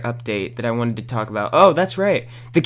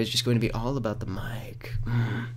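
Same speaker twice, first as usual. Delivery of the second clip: fully whispered aside; 2 semitones lower, 10 dB quieter than usual. Acted. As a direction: whisper it like a secret. Whispers about the mic.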